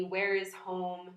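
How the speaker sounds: normal talking voice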